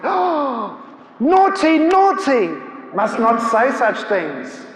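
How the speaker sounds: theatrical mock scolding. Imposing visitor playing to watching crowd, theatrical mock scolding.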